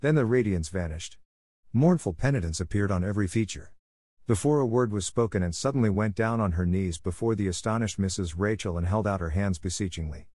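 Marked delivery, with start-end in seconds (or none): none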